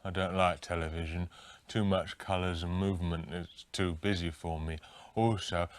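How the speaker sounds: dopey mumbling Graham. Pretend. Graham spoofed answering the interviewer, dim and mumbling. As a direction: sound lost and easily confused.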